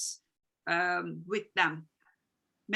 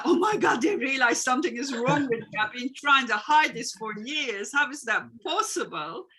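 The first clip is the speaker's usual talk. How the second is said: much higher and louder, bursting out in alarm; volcanic outburst; 4 semitones higher, 8 dB louder than usual. Retold or imitated. Person whose secret is found erupts, shouting in alarm. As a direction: erupt like a volcano, panicked and loud. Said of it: "like a volcano".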